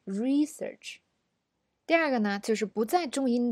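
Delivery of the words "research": research with RE held long; REEE-search, weight up front; noun stress on first syllable. Shown as a demonstration. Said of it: "re这个时候要长一点".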